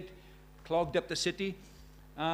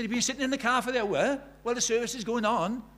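higher and louder, disbelieving; can't believe he sat out the hour; incredulous question; 7 semitones higher, 5 dB louder than usual. Retold or imitated. Incredulous at man in car.

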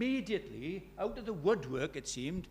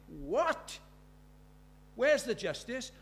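mock outraged question, pitched up; indignant disbelief; 7 semitones higher, 3 dB louder, much slower than usual. Two women asking where justice is, indignant disbelief. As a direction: demand an answer on the victims' behalf.

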